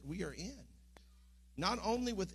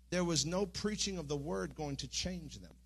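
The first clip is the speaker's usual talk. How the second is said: usual speaking voice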